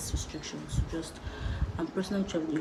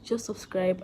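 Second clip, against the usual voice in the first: ordinary talk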